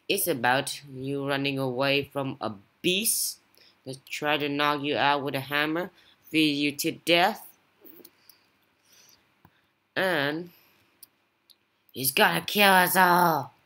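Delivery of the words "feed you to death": ordinary delivery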